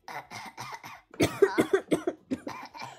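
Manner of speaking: raspy throat growl. Shown as a demonstration.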